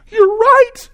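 very high, loud exclamation; astonished realization. Retold or imitated. Student conceding to the speaker after gasping, astonished cry. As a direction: squeal it as if suddenly floored.

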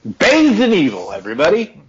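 dramatic. Acted. Cleric dramatically announces evil to party.

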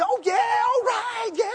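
high, drawn-out jubilant shout; loud cheering. Retold or imitated. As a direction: cheer like a man let off the hook. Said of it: "another whoop ahead in line".

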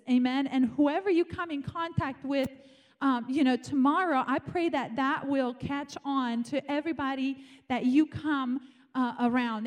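usual speaking voice

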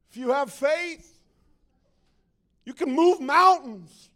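loud, high pulpit delivery; rally the congregation at full voice; mock faith-preacher fervor; hyped-up preacher. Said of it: "preaching it in a way that is ungodly".

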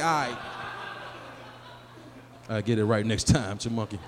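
low, resigned reply; deflated low-pitched acceptance; subdued compliance. Acted. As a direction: give in quietly, voice dropped low. Meekly answers the scolding friend.

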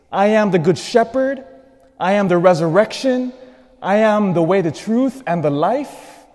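high, loud ringing proclamation; preaching at full force. Real, not acted. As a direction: proclaim each one to the rafters. Proclaims seven I am sayings.

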